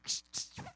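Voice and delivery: soft, voiceless shushing; urgent hushing. Acted. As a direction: shush a blabbing sister. Hushing the tattling sister.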